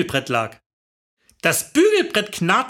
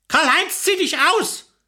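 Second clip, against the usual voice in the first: high-pitched call across the room; bossy summons, pitched up; 7 semitones higher than usual. A narrator's reading. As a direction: call over to the couch. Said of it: "rief ich zur Couch rüber".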